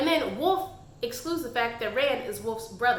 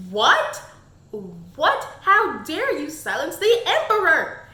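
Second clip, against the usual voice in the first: loud, higher indignant outburst; outraged; 4 semitones higher, 8 dB louder than usual. Retold or imitated. Reader reacting to the queen silencing Kai, loud outraged protest. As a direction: blast it like a reader betrayed by the plot.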